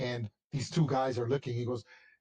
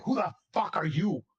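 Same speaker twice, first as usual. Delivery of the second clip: higher, louder startled challenge; aggressive guard; 6 semitones higher, 4 dB louder than usual. Acted. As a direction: bark at the stranger. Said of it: "the guy's like".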